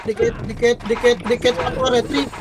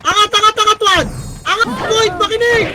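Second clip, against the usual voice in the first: higher and louder, urgent orders; excited high shout; 6 semitones higher, 4 dB louder than usual. Real, not acted. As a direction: drive the team forward, keyed up. Shotcaller pushing team up urgently. (mataas at malakas na utos)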